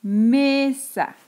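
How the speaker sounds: "Mesa" with ME stretched, dropping on "sa"; sílaba tónica marcada. Shown as a demonstration. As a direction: lean slowly on the first syllable of "Mesa". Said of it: "voy a subrayar la sílaba tónica". ("Mesa" con la ME alargada y marcada)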